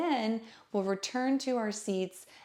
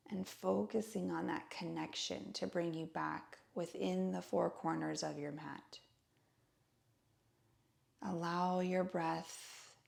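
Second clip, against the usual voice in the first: guided meditation; 2 semitones lower, 7 dB quieter than usual. Acted. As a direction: guide listeners gently into stillness, hushed and unhurried. Leads a seated breathing meditation.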